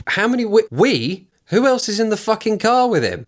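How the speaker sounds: high, disbelieving outburst; incredulous. Real, not acted. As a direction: scoff at the repeated we. Incredulous rant at driver's quote.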